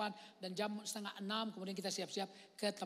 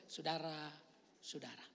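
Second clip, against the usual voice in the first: slow calm preaching; slower than usual. Retold or imitated. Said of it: "Dia itu khotbah itu biasa".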